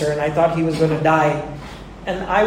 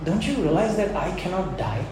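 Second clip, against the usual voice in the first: dying friend's quiet comfort; 8 dB quieter than usual. Retold or imitated. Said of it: "He said".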